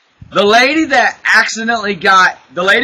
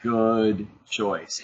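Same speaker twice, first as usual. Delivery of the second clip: low, soft, slow knowing aside; low quiet aside; 10 semitones lower, 8 dB quieter, slower than usual. Retold or imitated. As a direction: murmur it slowly to the ladies. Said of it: "I go".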